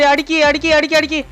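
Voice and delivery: high, loud shoot urging; urging shout. Real, not acted. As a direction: yell at the player to shoot. Urging shot mid-song.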